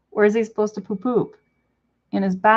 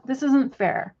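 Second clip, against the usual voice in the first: ordinary delivery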